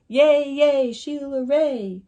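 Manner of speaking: loud, high cheer of friends; cheering yell. A narrator's reading. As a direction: yell it like a cheering crowd of kids. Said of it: "her friends yelled".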